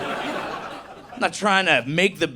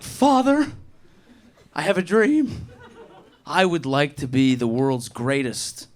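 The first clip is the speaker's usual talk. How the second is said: lightly voiced solemn child, raised pitch; a hint of an earnest boy confiding; earnest son voice; 3 semitones lower, slower than usual. Acted son addressing his father.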